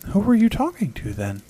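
put-on high little boy calling up; a small boy calling out curiously; ghost child calling. A narrator's reading. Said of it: "The ghost child called up".

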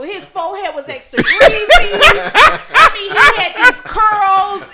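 loud laughing roast. Real, not acted. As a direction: shout it through laughter. Roasting old photo, laughing.

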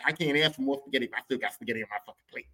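ordinary delivery

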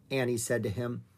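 ordinary delivery, nothing marked

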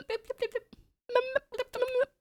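very high, slow squeaky nips. Acted. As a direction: nibble it out in a tiny squeak.

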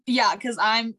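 usual speaking voice

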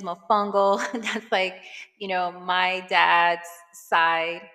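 ordinary talk, nothing marked

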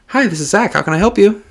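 high-pitched, polite phone opener; customer service voice. Shown as a demonstration. Clerk starting a customer call, customer service voice. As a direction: put on an overly nice phone voice. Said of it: "Gotta have your fucking voice".

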